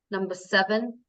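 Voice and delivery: ordinary delivery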